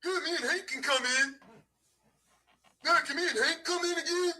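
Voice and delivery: high mocking voice; mocking Vinny. Mocking Vinny asking to join the stream. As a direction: mimic him asking to come in.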